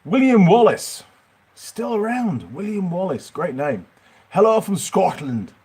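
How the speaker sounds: high-pitched, hammed-up Scottish accent; a Yorkshireman having a go at Scottish; attempted Scottish accent. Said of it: "trying to do a Scottish accent".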